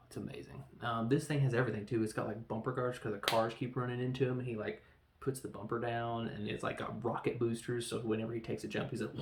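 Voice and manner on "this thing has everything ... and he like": normal talking voice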